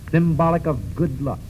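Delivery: usual speaking voice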